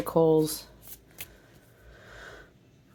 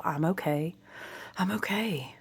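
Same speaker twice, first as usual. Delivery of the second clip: quiet, gentle repeated comfort; calm yourself down softly; soft self-reassurance; 9 dB quieter than usual. Pretend. Telling viewers to soothe themselves, hushed and tender. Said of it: "Remind yourself".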